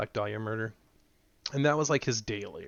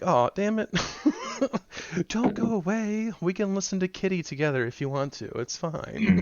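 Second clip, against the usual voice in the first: mock pleading; 9 semitones higher, 3 dB louder than usual. Begging a fleeing newcomer to stay, hammed-up high whine. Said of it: "I'm like".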